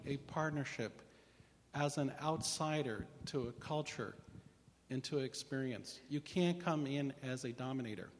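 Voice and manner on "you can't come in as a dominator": ordinary delivery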